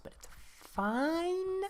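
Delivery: high, slow sing-song falsetto on fine; a high, drawn-out falsetto covering the loss; falsetto it's fine. Acted. Player consoling himself over lost cards in a high slow sing-song. Losing vacuums, forced cheer.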